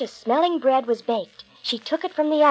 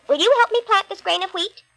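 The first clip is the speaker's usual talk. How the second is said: high eager hen voice; little red hen; 8 semitones higher, 3 dB louder than usual. Acted. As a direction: ask brightly as the little hen. Hen's request again.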